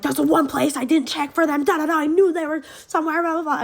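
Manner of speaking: mock angry rant. Stepdad ranting about where phones were hidden, mock angry. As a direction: rattle off a hammed-up scolding. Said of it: "he was really mad about that".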